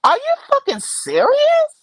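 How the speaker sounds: high, disbelieving outburst; can't believe it, pitch it way up; high-pitched disbelief; incredulous. Real, not acted. Ranting about a streamer.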